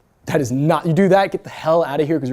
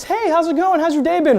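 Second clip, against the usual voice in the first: high, chatty easygoing greeting; easygoing small talk; 11 semitones higher than usual. Shown as a demonstration. Easygoing caller making small talk, light and friendly. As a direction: open breezily, pitched well up. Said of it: "I'm an easygoing, small talk".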